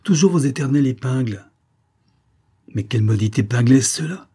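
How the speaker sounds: irritated exclamation. A narrator's reading. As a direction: complain loudly, a touch cross. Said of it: "s'écria-t-il en secouant la main". (exclamation exaspérée)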